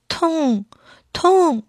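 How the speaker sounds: high, drawn-out little cry of pain; a sick little fish moaning, lightly acted; mild tearful moan. A narrator's reading. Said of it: "小金鱼看见奶奶就哭起来， 轻轻地叫着".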